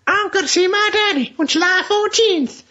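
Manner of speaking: very high, put-on squeaky reading; mock a child's letter in a high falsetto; John Malkovich. Imitating the villain reading out the letter, high mock voice. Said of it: "reading out the letter".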